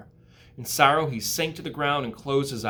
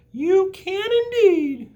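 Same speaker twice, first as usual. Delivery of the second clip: very high, slow tiny voice; tiny spider greeting the pig; small spider voice; 9 dB louder, much slower than usual. Acted. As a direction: squeak it like a baby spider. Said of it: "said the spiders".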